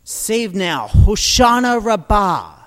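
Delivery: loud ritual cry. Retold or imitated. Crowd at the altar crying out, loud. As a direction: call it out to the heavens. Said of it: "they would cry out".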